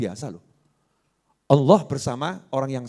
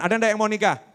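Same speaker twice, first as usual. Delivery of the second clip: ordinary delivery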